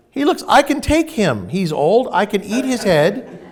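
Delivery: a hungry bug eyeing his friend, half laughing; hungry insect voice. Pretend. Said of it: "Imagine if, you know, we were insects".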